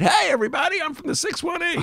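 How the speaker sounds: high-pitched impression of a guy hailing his crowd; doctor impression. Imitating the doctor announcing his area code to everybody, high-pitched impression. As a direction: call out high like an excited hometown booster. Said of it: "that's my doctor impression".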